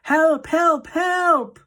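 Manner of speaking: high, loud desperate cry; scream for help. A narrator's reading. Terrified boy screaming for help towards the door. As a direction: yell as if someone outside must hear. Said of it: "I screamed".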